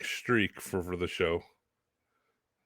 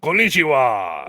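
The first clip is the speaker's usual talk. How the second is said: mock Japanese greeting; 3 semitones higher, 7 dB louder than usual. Cohost jokingly imitating the wrestler answering the phone, hammed-up loud greeting. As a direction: call it out like a hammed-up hello.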